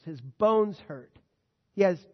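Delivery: usual speaking voice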